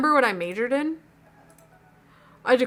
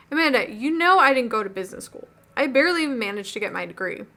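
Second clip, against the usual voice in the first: usual speaking voice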